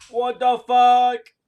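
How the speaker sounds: long, high, very loud yell; outraged shout. Real, not acted. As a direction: shout it out, stretching every word. Outburst at the game.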